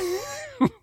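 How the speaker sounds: high burst of laughter; sudden high laugh. Real, not acted. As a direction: crack up at the joke. Laughing at the Jackson Pollock joke.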